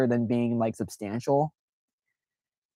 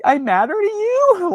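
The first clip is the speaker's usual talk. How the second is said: much higher and louder, through laughter; laughing disbelief; 6 dB louder than usual. Retold or imitated. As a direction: laugh it out, overwhelmed. Retold touched reaction.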